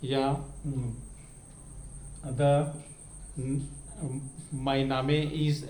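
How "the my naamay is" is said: name misread as NAA-may, halting reading; बच्चों का गलत उच्चारण. Retold or imitated. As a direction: imitate children sounding the final e in name. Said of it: "ऐसे कह देते हैं". (name को नामे पढ़ते हुए)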